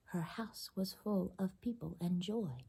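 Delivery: whispered. Acted. Storyteller hushes the moral.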